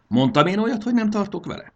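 high, loud, offended retort; indignant anger. A narrator's reading. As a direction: hurl the question back crossly. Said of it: "Marci mérgesen toppantott". (mérges, felháborodott visszavágás)

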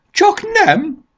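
very high, loud squeal of alarm; frightened shriek. A narrator's reading. Táska Mása fears for the travelling trunk, frightened shriek. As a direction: shriek in horror, far above normal pitch. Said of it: "visított ilyetten Táska Mása".

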